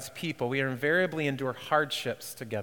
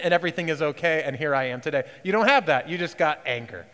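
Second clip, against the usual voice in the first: usual speaking voice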